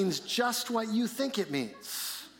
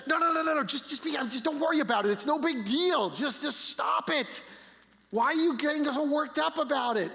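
pleading parent; 6 semitones higher than usual. Retold or imitated. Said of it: "You have said".

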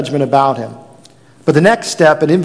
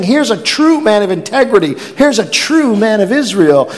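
admiring; 7 semitones higher than usual. Acted. Paraphrase of Jesus' words.

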